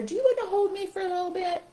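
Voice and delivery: high, coaxing puppet voice; high-pitched animal character; Softy. Shown as a demonstration. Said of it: "This is Softy".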